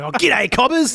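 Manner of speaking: high, loud broad Australian hello; fake Aussie greeting. Mocking fake tourists with broad Australian. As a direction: ham up the ocker Australian greeting. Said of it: "people with fake American accents".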